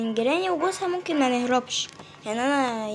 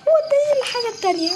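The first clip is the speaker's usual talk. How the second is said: panicking gamer; 10 semitones higher, faster than usual. Real, not acted. Gamer still panicking, fast.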